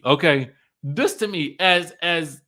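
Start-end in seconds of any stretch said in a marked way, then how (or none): none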